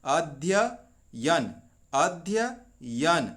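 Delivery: "अध्ययन" drawn out very slowly, each syllable separate. Shown as a demonstration. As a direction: spell out "अध्ययन" slowly like a teacher. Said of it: "अध्ययन ऐसे नहीं". ("अध्ययन" बहुत धीरे, एक-एक अक्षर अलग)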